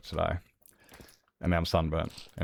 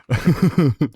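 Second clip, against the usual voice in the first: high, loud giggle; embarrassed laugh; 7 semitones higher, 7 dB louder than usual. Real, not acted. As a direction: laugh off your own silly voice. Embarrassed after the Elmo voice.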